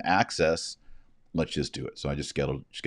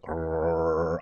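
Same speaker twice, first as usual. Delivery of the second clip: imitated drill rumble; 4 dB quieter than usual. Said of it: "like, rumble".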